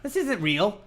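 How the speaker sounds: mock disbelief. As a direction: protest like a put-out kid. Voices disgusted kid at friend's.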